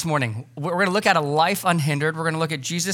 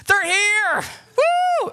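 much higher, louder excited squeal; imitated children's excited yell; kids screaming; 3 dB louder, much slower than usual. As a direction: shriek like a kid whose guests just arrived. Kids scream as guests arrive.

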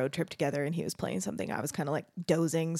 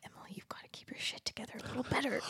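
quick hushed whisper to her sister; breathless whispered scolding, much softer; 4 semitones higher, 12 dB quieter, faster than usual. Retold or imitated. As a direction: whisper it fast, like a private warning. Advice to younger sister, retold.

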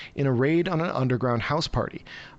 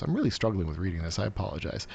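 muttered apology, softer; quiet aside; 6 semitones lower, 6 dB quieter, faster than usual. Real, not acted. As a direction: drop to a quick quiet aside. Quiet aside about reading.

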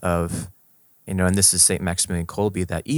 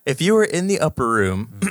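put-on country accent; 7 semitones higher, 3 dB louder than usual. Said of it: "in country accents".

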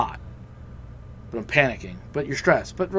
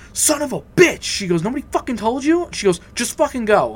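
high, loud angry cousin voice; 10 semitones higher, 4 dB louder than usual. Retold or imitated. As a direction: shout it up high, furious in disbelief.

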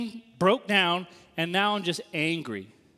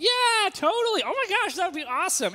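fake enthusiasm; 12 semitones higher, 4 dB louder than usual. Student accepting the professor's invite with over-the-top fake cheer. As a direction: overplay polite delight.

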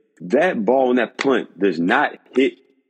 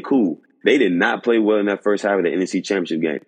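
ordinary delivery

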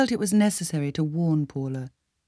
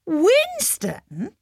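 much higher, louder rising disbelief; incredulous; 6 dB louder than usual. A narrator's reading. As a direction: echo the name in disbelief. Paula shocked at the name.

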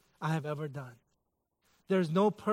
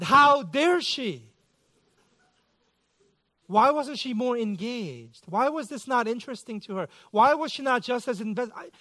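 mock indignation; 4 semitones higher than usual. Preacher mocking his own pride at the yawn, hammed-up indignation. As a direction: ham up the offended husband.

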